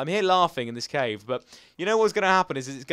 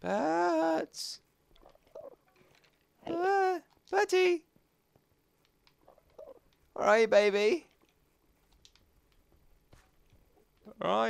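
cooing pet talk; 4 semitones higher, slower than usual. Real, not acted. Cooing to his lost pet Bertie, high and soothing. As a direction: soothe a frightened little pet.

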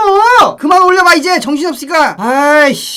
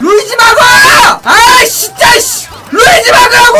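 high, strained frustrated shouting; exasperated yelling; 5 semitones higher, 3 dB louder than usual. Real, not acted. Streamer ordering a teammate to block Luigi, exasperated yelling. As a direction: yell the order in real exasperation.

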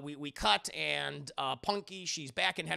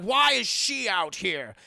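loud, slow, higher outburst; freaked-out demand; 4 semitones higher, 12 dB louder, slower than usual. Acted. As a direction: demand it in drawn-out outrage. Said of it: "He freaks out".

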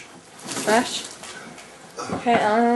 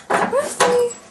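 high call to dog; 11 semitones higher than usual. Real, not acted. Calling the dog.

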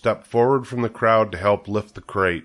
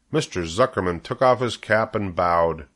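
usual speaking voice

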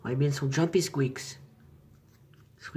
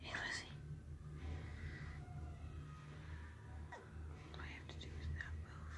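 whispering; 15 dB quieter than usual. Real, not acted. Whispering near skittish pet.

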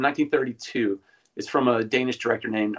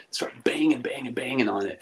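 hushed whisper for suspense. Acted. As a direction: breathe it out, voiceless and tense. Tense moment in ghost story.

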